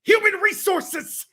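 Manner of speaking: high, drawn-out mock yell; Daddy Magic yell. Fan imitating wrestler echoing others' words, loud mad yell. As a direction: imitate a bug-eyed wrestler blurting it at full volume. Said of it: "Just yelling".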